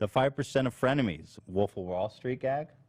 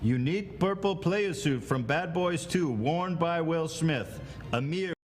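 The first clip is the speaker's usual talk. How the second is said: pompous grand lord; 5 semitones higher than usual. Acted. Lord appraising request grandly.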